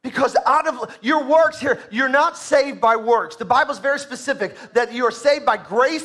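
loud, rising sermon delivery; full-force preaching. Acted. Pastor urging the church to grow, preaching loud. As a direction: drive it home to the congregation.

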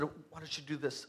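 very soft, low nudge; quiet prompting. Acted. God reminding a stubborn believer what to do, quiet prompting. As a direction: give a hushed, low reminder. Voices God's gentle reminder.

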